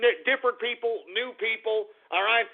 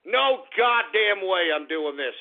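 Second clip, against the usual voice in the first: ordinary talk, nothing marked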